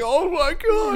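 high gasping oh my god; stunned. Retold or imitated. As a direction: squeal it out in disbelief. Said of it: "I'm like".

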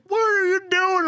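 high-pitched retold demand; mock exasperated boss; high incredulous quote. Retold manager confronting laughing employee.

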